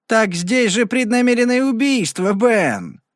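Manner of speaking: high, gloating exclamation; Luby gloating to the sheriff, triumphant. A narrator's reading. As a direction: crow over the find. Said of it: "торжествующе воскликнул Луби".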